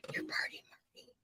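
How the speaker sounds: breathless fan whisper. Retold or imitated. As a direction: hush it like a secret.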